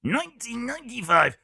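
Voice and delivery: a sudden high announcer swoop on ninety-five; odd high voice. Shown as a demonstration. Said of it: "I don't know why I did that with my voice".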